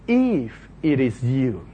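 doubtful Peter. Acted. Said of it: "So he says".

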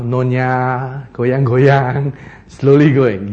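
slow, low, softened amble; slow pace. Retold or imitated. Maybe Elijah likes to amble along, slow and leisurely. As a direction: drag the words out gently at a strolling pace. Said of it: "he liked to go on a slower pace".

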